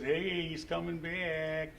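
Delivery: ordinary talk, nothing marked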